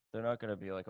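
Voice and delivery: ordinary talk, nothing marked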